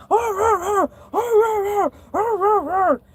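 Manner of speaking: high barking imitation; dog barking. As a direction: bark like the dog.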